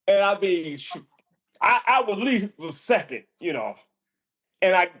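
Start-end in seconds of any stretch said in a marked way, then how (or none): none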